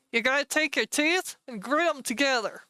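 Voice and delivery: ordinary talk, nothing marked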